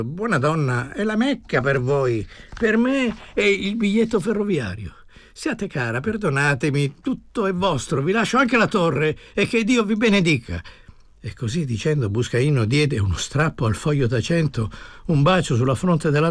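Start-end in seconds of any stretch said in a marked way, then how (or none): 0.0-10.6 higher-pitched, effusive plea; plead, then lift into a blessing; fervent pleading man. Acted. Departing man giving everything to the old woman, fervently pleading.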